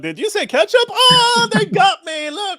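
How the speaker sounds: hammed-up whimpering sheikh; Sheikh Uthman mock. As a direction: fake crying victim. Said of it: "Sheikh Uthman".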